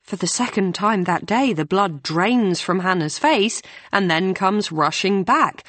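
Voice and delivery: ordinary delivery, nothing marked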